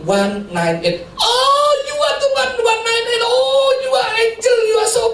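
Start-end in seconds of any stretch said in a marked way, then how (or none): 1.2-2.5 very high, crying out and half sung, gushing thanks; gushing shopkeeper. Retold or imitated. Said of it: "Saya dibilang malaikat". 2.6-3.2 shopkeeper's shriek. Retold or imitated. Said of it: "Saya dibilang malaikat". 3.3-5.0 very high, crying out and half sung, gushing thanks; gushing shopkeeper. Retold or imitated. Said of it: "Saya dibilang malaikat".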